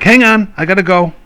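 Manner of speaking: high, urgent dog voice; the dog begging to stop. Pretend. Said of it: "use that dog voice".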